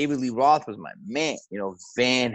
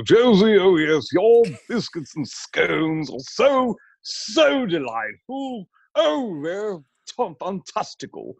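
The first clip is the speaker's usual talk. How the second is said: put-on posh British accent, much higher and very slow, drawing out each word; gushing over the scones like a refined British guest, high-pitched and slow; Reginald's British voice; 9 semitones higher, 3 dB louder, slower than usual. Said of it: "he'd talk like this".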